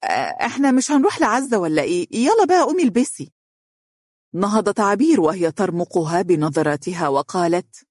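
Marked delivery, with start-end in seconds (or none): 0.0-3.3 high, quick change of subject; evasive brightness. Acted. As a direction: hurry her along in a raised voice.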